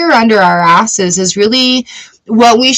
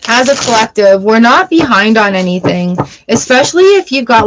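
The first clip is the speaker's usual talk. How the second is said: ordinary talk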